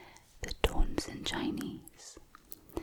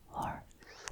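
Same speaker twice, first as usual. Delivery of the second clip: usual speaking voice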